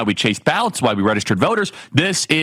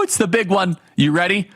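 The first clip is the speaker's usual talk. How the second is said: ordinary delivery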